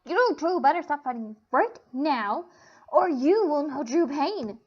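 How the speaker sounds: slow, loud booming threat; menacing booming warning. A narrator's reading. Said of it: "loud and booming, wasn't even female".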